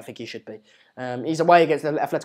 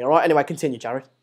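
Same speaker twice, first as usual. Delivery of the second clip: ordinary delivery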